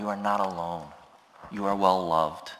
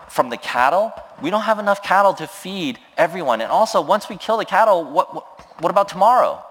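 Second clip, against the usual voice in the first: much higher, louder, fast and flustered; panicked doubting Moses; 9 semitones higher, 5 dB louder, faster than usual. Retold or imitated. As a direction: sputter like an overwhelmed leader. Moses doubting God's provision.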